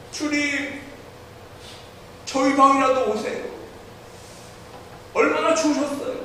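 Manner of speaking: high, soft tender invitation; gentle childlike plea. Retold or imitated. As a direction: invite Jesus in like a pure-hearted child. Ralph's heart voiced to Jesus. (높고 부드럽게 간청하는 아이 목소리)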